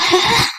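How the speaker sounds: short high giggle; a pleased little giggle at her own cleverness. Acted. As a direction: sly giggle of a gossiping bahu. Bahu laughs at her gossip.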